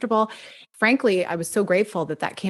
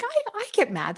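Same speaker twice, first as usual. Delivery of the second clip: 1 mock protest; 13 semitones higher, 4 dB quieter than usual. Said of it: "I'm like".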